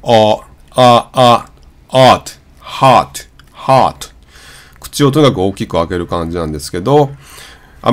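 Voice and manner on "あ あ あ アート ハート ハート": あ with the jaw dropped and rounded, then ハート as hot; American hot vowel demonstration; tall round mouth. Shown as a demonstration. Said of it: "縦に丸くか".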